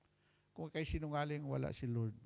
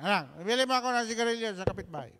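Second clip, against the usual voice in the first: put-on drunk father; 5 semitones higher, 9 dB louder, faster than usual. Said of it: "Sabi ng tatay na lasing".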